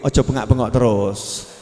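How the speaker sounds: low-pitched, restrained telling-off; lightly acted low reproof; mild admonishing. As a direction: play a weary dad advising his wife. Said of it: "Nasihat ibunya".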